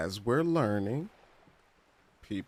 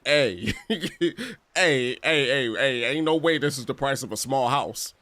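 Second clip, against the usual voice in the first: louder, laughing hey hey hey; loud laughing protest; 6 semitones higher, 7 dB louder than usual. Retold or imitated. Shopper balking at a price like a house, loud laughing protest. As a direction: call out the price, half laughing.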